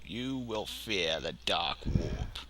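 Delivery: low, slow, soft sorcerer menace; asked to perform, low slow Chaos Sorcerer voice. Shown as a demonstration. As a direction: intone it like a dark sorcerer. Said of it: "what does that sound like".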